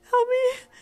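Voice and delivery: high, loud tearful cry; desperate plea. Acted. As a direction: wail for help through gasps. Panicked caller begs for help.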